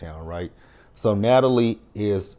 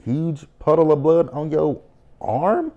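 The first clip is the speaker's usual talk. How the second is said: higher, slower, stretched out for effect; amazed exaggeration; 6 semitones higher, 4 dB louder, slower than usual. Real, not acted. Recapping the show to viewers, amazed and drawn out. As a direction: paint how huge it was.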